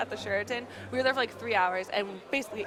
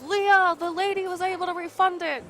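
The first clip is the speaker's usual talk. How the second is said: imitating Chance; 6 semitones higher than usual. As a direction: call out the good news as Chance. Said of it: "Chance says".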